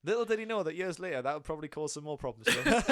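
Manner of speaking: real laughter rising into a high burst; laughing while talking. Real, not acted. Reacting to a fun fact, real laughter. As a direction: laugh through the joke.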